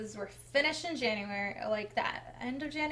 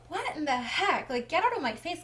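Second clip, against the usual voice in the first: higher-pitched, retold indignation; indignant protest; 5 semitones higher, 3 dB louder than usual. Wife to husband getting in her face, indignant. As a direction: push back in disbelief, mildly acted.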